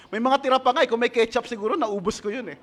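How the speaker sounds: laughing as the joke rolls out; amused, chuckling between phrases; joking through laughter. Real, not acted. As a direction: crack yourself up telling it. Joking about eating paper, laughs.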